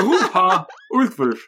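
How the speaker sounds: slow mock Norwegian accent, laughing; hammed-up Norwegian name, drawn out. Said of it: "attempting a, uh, Norwegian accent".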